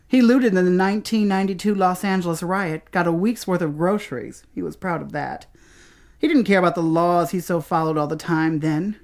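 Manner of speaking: ordinary talk, nothing marked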